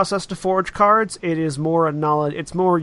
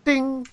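chime ding; 10 semitones higher than usual. Acted. GM voices cabin chime.

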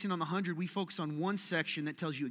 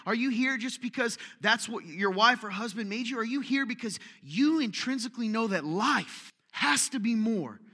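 fiery preaching; 5 semitones higher than usual. Acted. Pastor challenging sleepy churchgoers, raised urgent preaching. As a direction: press the congregation to answer.

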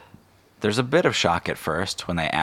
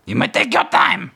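high, loud, solemn bird voice; raven decree; 14 semitones higher, 7 dB louder than usual. Acted. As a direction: announce the prophecy like a raven herald. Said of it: "And it flaps away".

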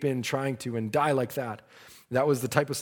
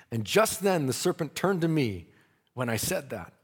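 usual speaking voice

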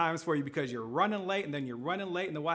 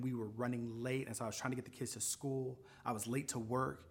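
subdued confiding; 4 semitones lower, 6 dB quieter than usual. Retold or imitated. Retold cashier confides her troubles.

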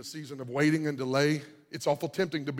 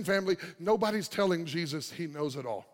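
ordinary talk, nothing marked

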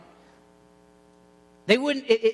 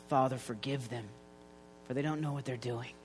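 quiet prayer; 8 semitones lower, 11 dB quieter than usual. Retold or imitated. Jesus prays on the cross.

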